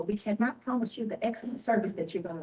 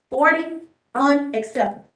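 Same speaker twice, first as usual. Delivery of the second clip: louder, exasperated verdict; indignant; 3 semitones higher, 7 dB louder than usual. Real, not acted. Official listing turnout figures, louder indignant verdict. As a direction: hammer the verdict. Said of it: "I'm a little emotional".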